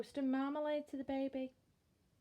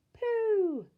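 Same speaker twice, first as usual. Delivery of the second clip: put-on high, loud baby squeal; baby copying; 12 semitones higher, 8 dB louder than usual. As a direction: parrot it like a toddler. Baby copies Bill.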